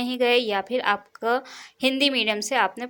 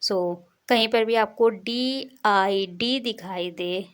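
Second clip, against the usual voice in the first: ordinary delivery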